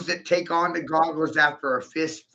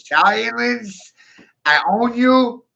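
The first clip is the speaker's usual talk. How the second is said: mock shout; 8 semitones higher, 7 dB louder, slower than usual. Ranting, voice jumps.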